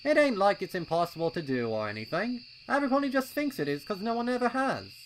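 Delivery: high, loud, impatient colt voice; a keen little colt itching to go; eager young colt. A narrator's reading. Pipsqueak urging his friends at the trail, high and loud.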